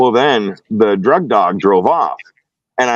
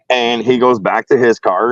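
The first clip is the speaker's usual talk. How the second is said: usual speaking voice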